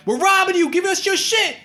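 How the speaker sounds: very high, loud mock threat; high mock robber. Robbers sticking up the bickering pair, very high mock threat. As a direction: squeak the holdup like a cartoon thug. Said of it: "They're like".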